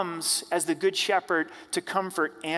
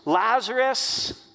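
a loud, slow call, pitched higher; loud cry; 4 semitones higher, 4 dB louder, slower than usual. A narrator's reading. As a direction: call him out of the tomb with a loud, drawn-out cry. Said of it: "he cried with a loud voice".

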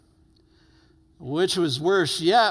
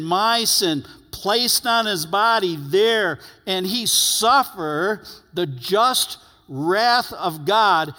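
louder, driving preacher's build; fervent preaching; 4 semitones higher, 5 dB louder than usual. Acted. Pastor preaching to the congregation about the cross, louder and fervent. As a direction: drive the cross home to the hearers.

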